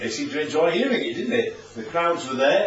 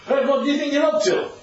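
higher-pitched mock indignation; miffed challenge; 8 semitones higher than usual. Temple leaders demanding Jesus explain himself, miffed. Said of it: "so they're rather miffed about it".

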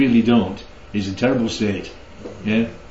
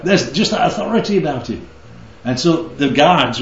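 normal talking voice

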